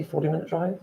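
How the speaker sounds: ordinary delivery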